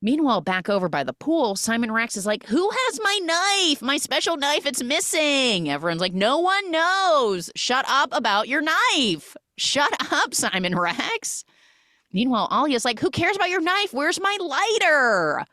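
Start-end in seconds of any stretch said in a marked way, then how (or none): 2.5-5.7 high, drawn-out whiny complaint; mock outraged demand. Simon Rex demands his missing knife, mock outraged. 6.1-11.4 high, stretched-out mock yell; mock exasperation. Everyone answers Simon about his knife, mock exasperated. Group snaps back at Simon. 13.0-15.5 high-pitched theatrical whine-yell; mock exasperated demand. Alia wants her lighter back, mock exasperated demand.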